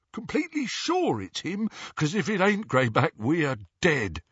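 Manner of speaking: high, anxious doubting voice; nervous henchman. A narrator's reading. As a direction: question the boss with worry. Worried Snatcher doubts the leader.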